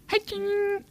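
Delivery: put-on very high, soft baby voice; baby talk to dog. Cooing to his dog Jane so she knows he's not mad, in a very high soft voice. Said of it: "And then I feel way better".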